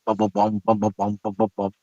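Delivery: a softly imitated song rhythm; mouthed drum beat. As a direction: tap out the beat with your mouth, quietly. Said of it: "that little African beat".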